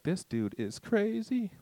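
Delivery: high, slow mock disbelief; drawn-out, high-pitched mock scoff at him; incredulous woman. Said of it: "she's like".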